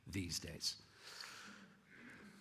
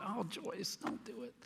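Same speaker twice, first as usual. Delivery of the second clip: higher, softer pleading thought; worried inner plea; 12 semitones higher, 6 dB quieter than usual. Retold or imitated. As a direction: beg her silently not to go, higher pitched. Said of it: "And I just thought".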